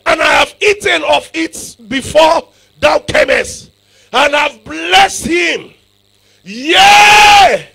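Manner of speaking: slow, shouted, high pulpit proclamation; preaching at full force. Acted. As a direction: stretch out Isaac's vow and shout it. Preacher voicing Isaac's oath.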